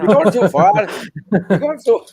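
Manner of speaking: a touch of mock Irish accent, pitched high; playful attempt at an Irish accent. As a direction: lightly try an Irish accent, going up high. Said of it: "Irish accent".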